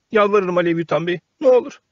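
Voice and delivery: high, rising desperate plea; begging. A narrator's reading. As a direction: beg at his feet. Pinocchio begs at his feet.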